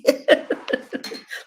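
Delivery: laughing it off; breathy high laugh. Real, not acted. Laughs after "you wanna cry.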